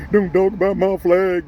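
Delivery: high, whiny mock of a patriot; mocking flag defender. Ranting at viewers, mimicking a flag defender's whine. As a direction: sneer it like a touchy patriot.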